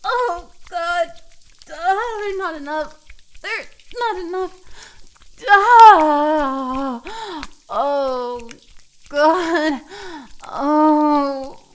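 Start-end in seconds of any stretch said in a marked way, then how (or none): 5.5-7.0 long, very loud open moan; drawn-out overwhelmed "ah". Acted. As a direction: cry out and let it fade. Overwhelmed in intimate roleplay scene.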